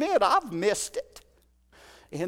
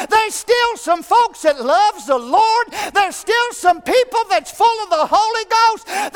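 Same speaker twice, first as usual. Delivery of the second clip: loud, high, shouted revival preaching; preaching at full force; 9 semitones higher, 6 dB louder than usual. Acted. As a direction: fire up the church.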